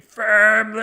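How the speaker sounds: drawn-out, high, very loud yell; screaming movie hero. Acted. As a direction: bellow it like a hammed-up action hero leaping off a mountain. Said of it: "he has to be screaming".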